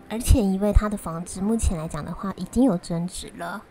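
ordinary talk

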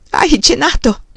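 high, tearful question. A narrator's reading. Wife crying as she asks about supper, tearful.

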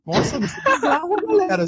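very high, laughing protest; laughing disbelief. Real, not acted. Protesting to friends that it is bizarre, laughing disbelief. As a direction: object while cracking up.

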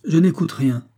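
high, loud refusal; defiant interruption. A narrator's reading. Laurent cutting off his opponent, defiant interruption. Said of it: "interrompit Laurent".

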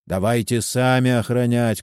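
ordinary delivery

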